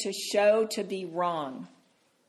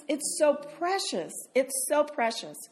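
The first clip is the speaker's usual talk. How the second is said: normal talking voice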